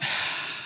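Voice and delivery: long, soft sigh; deep calming breath. Acted. Squirrel calms himself, deep sighing breath. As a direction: breathe out to settle down. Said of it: "he took a deep breath".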